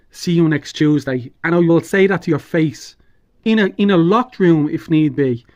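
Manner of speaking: loud, furious tirade of insults; furious shouting. Real, not acted. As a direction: shout the insults in real rage. Furious rant at Klitschko.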